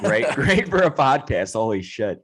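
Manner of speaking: words broken by laughter; laughing. Real, not acted. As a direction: laugh while you talk. Laughing at friend's yawn.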